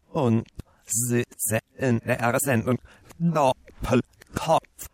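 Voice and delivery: backwards speech played in reverse, slow; slow, warped reversed audio; reversed playback. Shown as a demonstration. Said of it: "Wir hören uns jetzt mal an".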